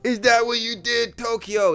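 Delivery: high, drawn-out incredulous holler; loud high-pitched mock outrage; hyped Wu Fei shouting. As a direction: stretch the first word, then yell it high in disbelief. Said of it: "Wu Fei's like".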